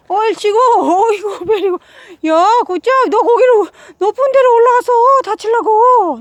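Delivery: loud, excited praise for a pet; talking to a dog. Real, not acted. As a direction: cheer the dog on, then scold fast.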